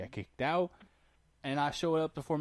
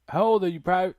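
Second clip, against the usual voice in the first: loud, high barked question; barking drill sergeant; 6 semitones higher, 11 dB louder than usual. Retold or imitated.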